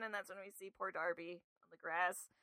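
usual speaking voice

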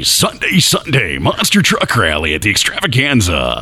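booming slow radio announcer hype; monster truck rally promo. Acted. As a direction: sell the rally like a Sunday promo. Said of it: "do one of your promos".

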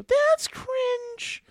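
put-on high, snooty teen voice; mocking teen voice. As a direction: sneer it like a bratty teen. Same mock voice repeated.

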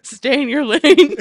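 loud and slow, mock scolding; sassy scolding. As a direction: drag it out like a playful warning. Scolding friend for double dipping.

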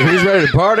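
loud excited party yell; bursting in yelling to party; party shout. Retold or imitated. Entering AA meeting party call.

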